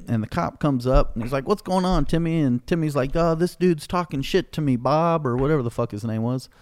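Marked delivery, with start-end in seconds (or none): 1.5-2.4 higher-pitched mimicked cop check-in; imitated familiar greeting. Retelling cop greeting Timmy. 3.1-5.3 higher-pitched mock tattling; imitated complaint. Retelling Timmy complaining to cop.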